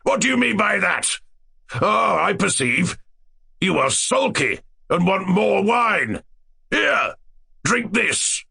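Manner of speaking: high-pitched, menacing royal demand; bully him, drawing words out slowly; fierce. A narrator's reading. Tyrant forcing wine on sulky jester, fierce. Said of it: "cried the tyrant fiercely".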